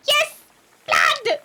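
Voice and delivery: high, loud, gleeful yell for blood; excited shout. Pretend. Player gleefully shouting at the murder details, eager and loud. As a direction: cheer for the gory news.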